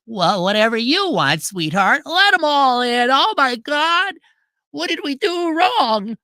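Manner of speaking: high, slow, whiny pushover voice; mock sensitive Minnesota man. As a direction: whimper it like a spineless husband. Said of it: "he is the epitome of a Minnesota man".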